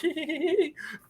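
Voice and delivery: high-pitched, drawn-out titter; mock snicker. Host imitating brewers sitting back and laughing, mock snicker. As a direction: imitate someone giggling to themselves. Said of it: "you're sitting back there and you're like".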